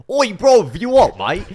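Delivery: high, loud mock Australian accent. Shown as a demonstration. Host shows their exaggerated fake Australian, loud Australian accent. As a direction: shout it in an over-the-top Australian accent. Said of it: "Or, like, our Australian".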